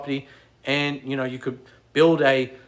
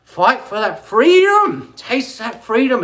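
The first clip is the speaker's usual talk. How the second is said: slow, much higher and louder call to fight; rallying cry; 8 semitones higher, 6 dB louder, slower than usual. Acted. Urging viewers to chase freedom, rousing cry. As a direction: shout it like a battle cry.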